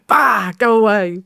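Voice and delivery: high, drawn-out shooing call; eagle-scaring voice. Shown as a demonstration. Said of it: "my eagle voice is better".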